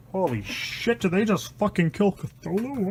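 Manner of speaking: usual speaking voice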